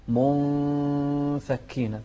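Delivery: منفكين with the hidden n hummed for two beats; held nasal sound. Shown as a demonstration. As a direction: stretch the nasal "mun" in منفكين before the f. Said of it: "بمقدار حركتين".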